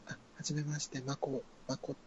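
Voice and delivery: soft female voice. Shown as a demonstration. Introducing herself as Mako in a soft female voice. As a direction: introduce yourself softly like a woman. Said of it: "結構センスある".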